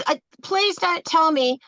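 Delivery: ordinary talk, nothing marked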